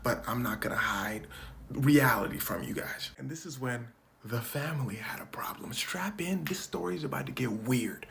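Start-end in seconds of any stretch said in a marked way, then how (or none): 3.2-5.7 whispered warning before the twist; conspiratorial whisper. Acted. Comedian warning viewers the story turns weird, whispering. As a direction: hush it like a secret.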